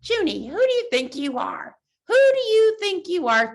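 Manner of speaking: sneering inner critic. Acted. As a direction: taunt her as the inner critic. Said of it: "This voice that's like".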